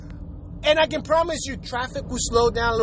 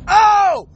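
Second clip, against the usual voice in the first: high, loud "oh" of frustration; exasperated yell; 5 semitones higher, 6 dB louder than usual. Acted. Driver blocked from cutting in, exasperated yell. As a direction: burst out in mock outrage.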